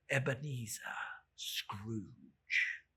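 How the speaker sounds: slow, breathless whisper of his own name; horrified whisper. Acted. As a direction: read the name in stunned dread. Reads his own gravestone.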